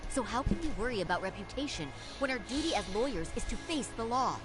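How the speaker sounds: high-pitched, impassioned appeal. Acted. Lawyer challenges sensei over their duty to the law, impassioned. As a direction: argue with rising fervour for your principles. Lawyer passionately argues against caution.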